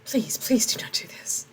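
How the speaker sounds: urgent, pleading whisper; desperate whisper. Acted. Tomas begging the soldiers, in a desperate whisper. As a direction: beg under your breath.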